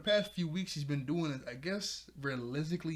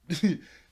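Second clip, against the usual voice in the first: laugh; 4 semitones higher, 12 dB louder than usual. Real, not acted. Excited before the team shot.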